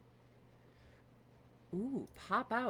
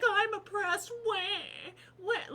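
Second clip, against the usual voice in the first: high mock wail; fake-cry it with a sneer; mocking crybaby; 11 semitones higher, 4 dB louder than usual. Mocking phone owners claiming oppression, high mock wail. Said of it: "and then be like".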